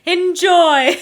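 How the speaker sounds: loud, high announcer-like shout; hammed-up gleeful send-off; mock cheery sign-off. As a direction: belt it out with fake enthusiasm. Parody blurb's cheery sign-off.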